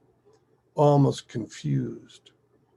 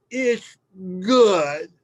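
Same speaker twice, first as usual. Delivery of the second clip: higher, louder slurred child imitation; pouty mouth full; 8 semitones higher, 8 dB louder than usual. Little girl insisting to Dad through lemon, pouty. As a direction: force it out round a mouthful of lemon. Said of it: "mouthful of lemon, mind you, she looks at me all pouty faced".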